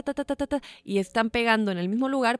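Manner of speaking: usual speaking voice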